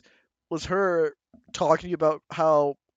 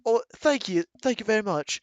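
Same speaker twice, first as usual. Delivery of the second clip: put-on British accent; 6 semitones higher than usual. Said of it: "And, like, a British accent".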